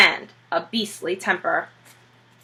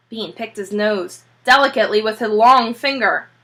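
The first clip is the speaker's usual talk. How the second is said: plain reading voice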